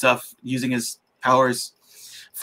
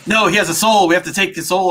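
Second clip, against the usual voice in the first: raised, louder hammy villain line; mock villain voice; 6 semitones higher, 4 dB louder than usual. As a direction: declare it like a cartoon baddie. Strangers voiced in retelling.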